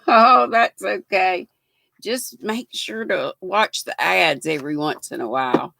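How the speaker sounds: ordinary talk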